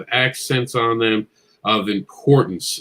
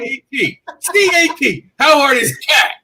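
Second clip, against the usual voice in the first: much higher, spelling it out in exasperation; mock disbelief; 14 semitones higher, 4 dB louder than usual. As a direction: act amazed anyone could miss such an easy word. Mocking friend's spelling mistake.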